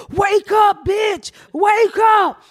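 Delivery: high shouted wake-up call; yelling. Retold or imitated. Yelling through the neighbor's door to wake her, high shout. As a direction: pound the door and yell it high.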